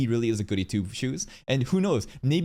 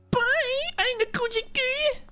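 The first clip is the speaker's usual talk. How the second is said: very high cutesy VTuber voice; sing-song it like a sweet anime idol; cute anime streamer. Pretend. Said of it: "This is the VTuber voice".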